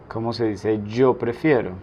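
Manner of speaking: normal talking voice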